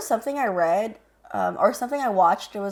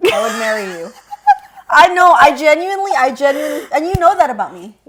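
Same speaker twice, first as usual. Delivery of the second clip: laughing; 5 semitones higher, 7 dB louder than usual. Real, not acted. Laughing declaration of love.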